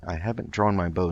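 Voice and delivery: ordinary talk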